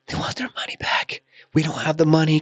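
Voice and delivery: whispery start rising to a high, upset plea; imitated crying. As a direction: mimic a tearful wife, breathy then high. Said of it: "she's crying. She's like".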